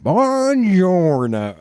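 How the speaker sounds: high, long drawn-out Southern drawl on Italian; bad Southern-accented Italian. Imitating Brad Pitt's film Italian, heavily Southern-accented. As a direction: stretch it out in a mangled Southern accent. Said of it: "he goes".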